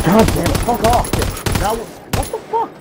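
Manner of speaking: real frustrated outburst; exasperated swearing. Real, not acted. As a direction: curse at the game, voice climbing. Swearing at losing in game.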